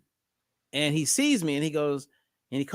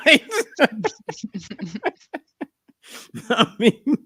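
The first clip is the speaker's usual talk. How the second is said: laughing; 9 semitones higher, 3 dB louder than usual. Real, not acted. Laughing at own joke.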